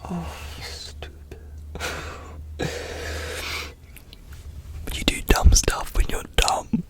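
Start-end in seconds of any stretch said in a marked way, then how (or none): 1.4-4.8 breathy giggle under the breath; whispered laugh. Real, not acted. Laughs at own dumb past.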